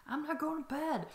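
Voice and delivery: imitating toddler. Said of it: "she was like".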